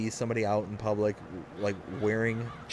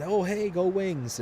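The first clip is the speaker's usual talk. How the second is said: cheerful fan greeting; 8 semitones higher, slower than usual. Retold or imitated. Retold stranger greeting.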